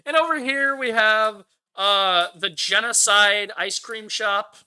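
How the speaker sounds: imitating the director pointing out the genocide ice cream shop; mock tour guide. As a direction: present it like a proud tour guide. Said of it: "the way he points to it".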